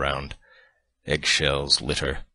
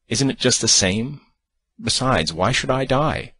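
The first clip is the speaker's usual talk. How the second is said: much higher, irritated; high, irritated retort; irritable; 8 semitones higher, 4 dB louder than usual. Acted. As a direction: snap back, pitch rising. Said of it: "she added irritably".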